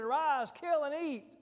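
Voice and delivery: imitating a commanding voice; retold command. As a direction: issue it as an order from heaven, high and loud. Retold heavenly command to Peter.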